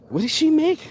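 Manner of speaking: put-on questioning girl. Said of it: "I think I saw a girl go".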